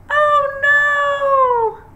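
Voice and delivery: sudden high loud oh no; surprised cry. Acted. Teacher reading the story, startled loud shout as letters fall. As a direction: shriek as if the tree just tipped over.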